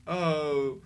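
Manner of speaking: ordinary talk, nothing marked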